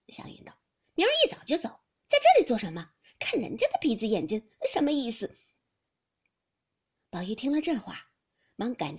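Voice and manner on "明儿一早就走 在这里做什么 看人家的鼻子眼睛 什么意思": louder, annoyed huff; 赌气抱怨. A narrator's reading. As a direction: complain in a huff about being slighted. 湘云赌气要走. (赌气地抱怨)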